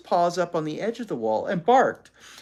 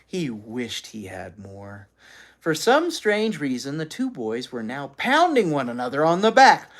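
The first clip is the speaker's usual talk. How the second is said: plain reading voice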